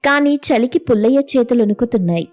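usual speaking voice

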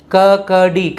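slow kakdi, each syllable separated. Shown as a demonstration. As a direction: sound out kakdi for learners. Teacher sounds out new word.

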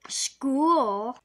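loud, stretched gasp of disbelief; shocked gasp. A narrator's reading. Family reacting to Jake's wish, loud shocked gasp. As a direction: gasp the word out in astonishment. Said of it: "gasped his family".

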